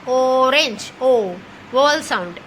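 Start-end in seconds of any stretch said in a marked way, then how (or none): 0.1-0.9 "ऑरेंज" sounded out with a long first O; sounding it out. Shown as a demonstration. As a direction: draw out the opening O of "ऑरेंज". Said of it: "हमने बोल के देखना है". ("ऑरेंज" का पहला ओ खींचकर बोलें)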